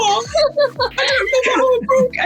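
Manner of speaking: high wailing fake sob; mock crying brother. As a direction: whimper like a crying little kid. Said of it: "he burst into tears so badly".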